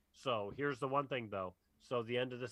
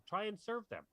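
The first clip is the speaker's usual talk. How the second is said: higher-pitched eager aside; higher little staff voice; imitated staff voice; 8 semitones higher, 4 dB quieter than usual. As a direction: lightly voice an eager server. Voicing cantina staff in retelling.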